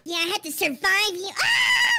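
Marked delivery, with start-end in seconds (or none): 1.4-1.9 startled cry. Acted. Catnap attacked.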